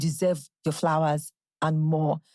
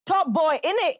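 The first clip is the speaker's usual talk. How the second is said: put-on high-pitched London street accent; English accent; 10 semitones higher than usual. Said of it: "like, an English accent".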